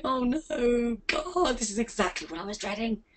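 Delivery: sinking, dreading groan; mock dread. Voicing the dreading viewer, soft mock groan. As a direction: play the reluctant note-taker. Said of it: "you're sitting there writing notes thinking".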